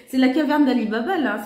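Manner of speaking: high, excited squeal over a new find; squealing delight. Real, not acted. She squeals with joy at finding another gem.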